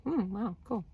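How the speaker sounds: dry, quiet sarcastic mumble; deadpan it, trailing off; sarcasm. Retold or imitated. Vlogger mocking a client's excuse, quietly sarcastic. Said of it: "I'm like".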